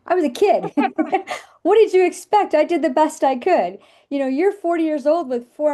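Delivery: high, loud, laughing protest; laughing exasperation. Retold or imitated. Mother answering her grown son's complaint, laughing high protest. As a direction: laugh it off while defending yourself.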